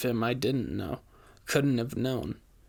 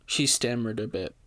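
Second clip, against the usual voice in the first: usual speaking voice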